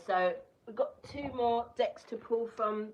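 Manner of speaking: ordinary delivery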